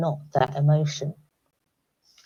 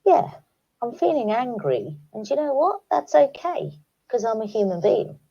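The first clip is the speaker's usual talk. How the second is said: higher, gently accepting inner voice; accepting self-talk; 5 semitones higher than usual. Shown as a demonstration. Coach demoing how to accept anger, lightly higher and kind. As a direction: say it as if making peace with yourself. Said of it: "And then accept it".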